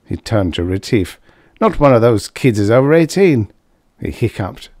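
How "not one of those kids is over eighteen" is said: a somewhat louder, higher tipsy loudmouth; Karsh, tipsy braggart. A narrator's reading. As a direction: play the boozy blowhard at the bar. Karsh voiced in story.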